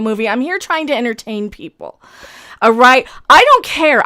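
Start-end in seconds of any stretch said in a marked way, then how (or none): 2.6-4.0 loud, exasperated dismissal; angry outburst. Real, not acted. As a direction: shut the troll down loudly. Angry rant at chat troll.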